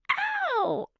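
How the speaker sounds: high squeaking ow like a hurt owl; high owl yelp. Shown as a demonstration. Guest copies the owl cry, high like a hurt yelp. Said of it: "Come on, try it for me".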